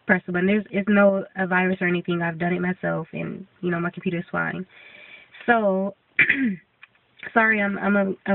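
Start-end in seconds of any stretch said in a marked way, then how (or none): 5.5-7.6 stuffy, congested voice. Real, not acted. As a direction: sounds congested from a cold. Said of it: "a little congested and".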